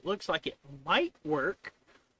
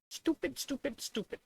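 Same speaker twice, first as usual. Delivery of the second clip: much higher sing-song stupid; mock ranting; 10 semitones higher than usual. Real, not acted. Frustrated coder vents at the code, high mock chant.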